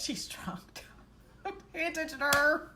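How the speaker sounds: put-on whispered excuse for a drunk friend; whispered aside. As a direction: whisper it as an apology to the table. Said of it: "I was like".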